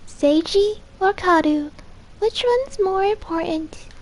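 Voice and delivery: put-on high, slow eerie little girl voice; sing-song creepy child.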